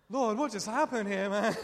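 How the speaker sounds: laughing bewilderment. Retold or imitated. Bewildered after the odd prayer.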